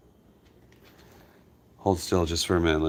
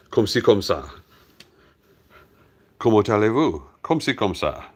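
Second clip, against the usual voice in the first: mock French phrases, a bit louder, in a put-on French lilt; showing off French; 3 semitones higher, 3 dB louder than usual. Shown as a demonstration. To French-speaking viewers, hamming up his schoolbook French. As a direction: recite schoolbook French with hammy confidence. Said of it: "that's as good as my French gets".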